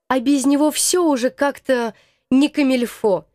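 plain reading voice

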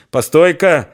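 loud, high call across the yard; shout from afar. A narrator's reading. Old man calls out to riders from afar. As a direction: stop them from a distance. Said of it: "крикнул он ещё издали".

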